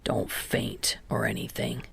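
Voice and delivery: low, soft, breathy grumble; a sulky grumble muttered low; grumbling. A narrator's reading. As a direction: grumble it under your breath, half whispered. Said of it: "Kageyama grumbles".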